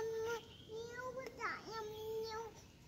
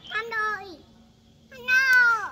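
calling out; 20 dB louder than usual. Real, not acted. Little girl calling out loudly to someone far away. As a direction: call out to him at full voice.

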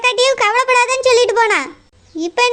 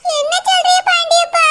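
put-on higher, tearful old woman lamenting; weeping grandmother; 7 semitones higher than usual. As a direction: wail over the family's shame. Grandmother asks in distress. (அவமானத்தால் அழுது புலம்பும் பாட்டி)

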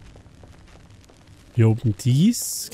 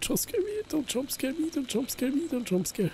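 scared horror game streamer; 13 semitones higher, 13 dB quieter than usual. Real, not acted. Pleading during horror game.

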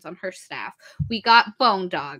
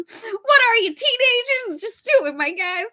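high, louder exasperated plea; exasperated outburst; 6 dB louder than usual. Retold or imitated. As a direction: urge the grown-ups to get on with it. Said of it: "And I'm like".

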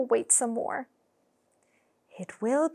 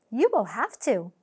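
plain reading voice

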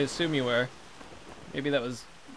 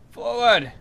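battle cry; 6 semitones higher, 6 dB louder than usual. Acted. Ordering the crew to attack.

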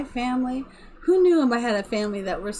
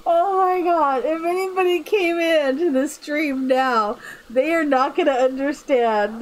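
laughing again; 4 semitones higher than usual. Real, not acted. Streamer laughing imagining newcomers' confusion. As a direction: laugh through every word.

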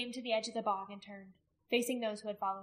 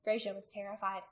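plain reading voice

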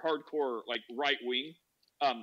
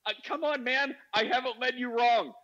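high-pitched acted pleading; exasperated plea; 7 semitones higher than usual. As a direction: beg a friend to see sense. Acting own exasperated plea.

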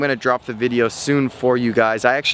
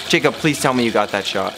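ordinary talk, nothing marked

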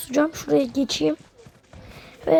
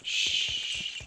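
shush; 6 dB quieter than usual. Real, not acted. Shushing himself while sneaking.

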